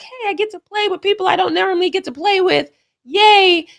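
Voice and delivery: high, bubbly mock cheer; gushing teammates. Mocking happy Olympic teammates, high and gushing. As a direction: chirp it like giddy All-Stars. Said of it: "guys are like".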